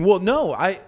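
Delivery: indignant imitation of a bad sport; mock protest. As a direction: protest like a sore loser, hammed up a little. Mimicking protesting receiver who dropped ball.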